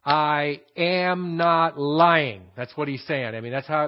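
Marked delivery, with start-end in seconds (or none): 0.1-2.4 raised pitch, then very slow word by word; slow, emphatic reading. Shown as a demonstration. Preacher reading Paul's oath as it should be heard, slowly emphatic. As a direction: draw out each word as a solemn assertion. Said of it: "that's how it should be read".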